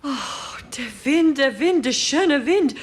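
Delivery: joyful. Acted. Celebrating the cracked weather message.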